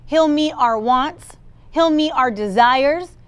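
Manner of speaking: ordinary talk, nothing marked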